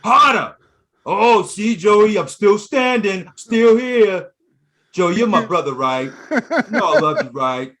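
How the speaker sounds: high, loud, insistent yelling; mock De Niro boxer. Boxer daring brother Joey to hit, high shouting. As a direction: goad him at full volume. Acting out Ridiculous Bull scene.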